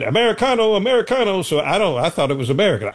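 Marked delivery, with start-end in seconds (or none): 0.0-1.4 mock shouted movie-soldier call, pitched high; movie Germans screaming. Recalling old war movies, Germans yelling, hammed-up shout. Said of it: "the Germans were screaming".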